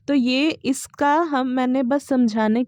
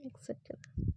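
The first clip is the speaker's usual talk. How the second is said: whisper; 16 dB quieter than usual. Real, not acted. Aside while checking notes.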